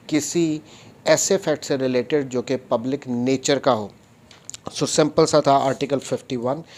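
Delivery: ordinary talk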